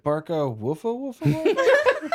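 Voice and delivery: high yappy dog barking words. Retold or imitated. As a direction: bark the words like a small dog. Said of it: "the dog's like".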